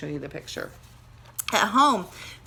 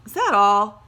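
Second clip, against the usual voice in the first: disappointed; 2 semitones higher, 10 dB louder than usual. Acted. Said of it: "sounding disappointed".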